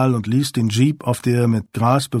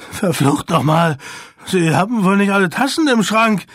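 a frightened man's shrill curse, raised and high; shrill cry; 7 semitones higher than usual. A narrator's reading. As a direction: snap it out in a shrill panic. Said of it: "rief er mit schriller Stimme".

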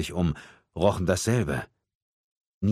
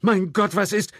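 high, loud panicked question; startled high-pitched shout; alarmed cry; 14 semitones higher, 6 dB louder than usual. A narrator's reading. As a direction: cry out from a frightened crowd. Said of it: "rief jemand neben Michelle".

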